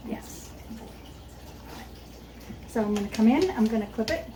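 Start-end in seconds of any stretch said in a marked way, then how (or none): 0.1-0.9 soft whispered praise, barely voiced. Real, not acted. Trainer quietly praising her dog, whispered. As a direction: breathe the praise gently to the dog.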